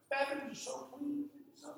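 high, soft lady's voice; a lady coming out of the bathroom, pleased; impressed lady. Retold or imitated. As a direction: lightly voice a delighted woman. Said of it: "She's like".

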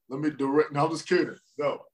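usual speaking voice

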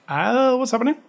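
high, puzzled mock confusion; a bemused viewer going what; confused viewer. Baffled viewer reacting to the Westworld timeline, in high mock confusion.

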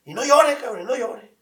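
imitating a tough dad shouting; father's yelled order. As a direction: snap the order at the kid. Father ordering son not cry.

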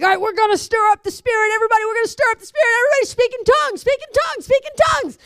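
high, loud, fast revival hype; imitating a frantic preacher urging everyone to speak in tongues; hyped-up leader. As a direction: pump up the room like a revival leader. Leaders whip up the crowd.

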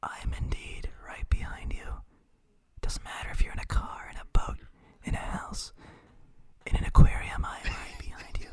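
unvoiced whisper, in separate phrases; creepy whisper. Co-host haunting the listener from behind, creepy whisper. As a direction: whisper a mock-sinister list, pausing between phrases. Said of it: "if I speak like this".

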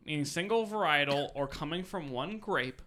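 normal talking voice

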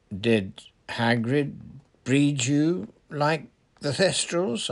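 slow, eager question. A narrator's reading. Dean questioning the centaur about his origins, eager. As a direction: ask with keen curiosity, drawing it out. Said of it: "asked Dean eagerly".

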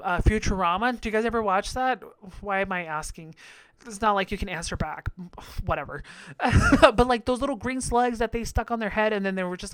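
ordinary talk, nothing marked

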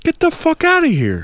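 very high, squeaky disbelief; hammed-up falsetto disbelief; incredulous outburst. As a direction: shriek it up high in mock outrage. Outraged at being taken for a meth user.

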